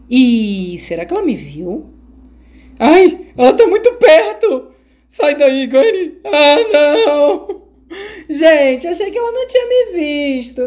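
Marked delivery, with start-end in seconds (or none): 2.8-10.7 high, loud scared whine; frightened squeal. Real, not acted. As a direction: squeal with fright as she nears. Granny close, hiding player panics. (guincho de medo)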